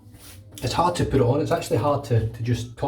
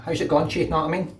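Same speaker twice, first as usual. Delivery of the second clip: higher, through-the-nose Glasgow accent; nasal Glaswegian; 4 semitones higher than usual. Shown as a demonstration. Showing viewers how to speak Glaswegian, nasal Glasgow accent. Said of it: "really talk through your nose".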